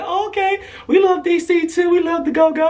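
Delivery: high-pitched, delighted imitation; enthusiastic approval. Singer tells escort she loves DC, imitated enthusiastic approval. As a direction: imitate her cheering him on, voice way up.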